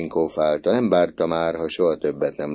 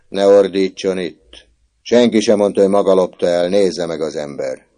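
stern rebuke; 4 semitones higher, 8 dB louder than usual. A narrator's reading. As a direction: silence him firmly. Prefect rebukes shouting boy.

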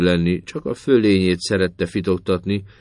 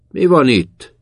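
higher, louder shouted demand; shouting in; 8 semitones higher, 9 dB louder, slower than usual. A narrator's reading. Sergeant shouting into the room, loud higher call. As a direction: bark it through the door. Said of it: "kiáltott be".

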